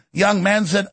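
usual speaking voice

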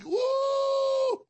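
high, loud victory yell; triumphant whoop. Real, not acted. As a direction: let out a big woo of triumph.

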